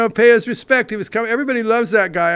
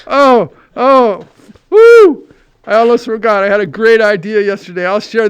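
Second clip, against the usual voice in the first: excited; 2 semitones higher, 9 dB louder than usual. Real, not acted. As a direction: whoop it out like you just remembered. Sudden remembered idea.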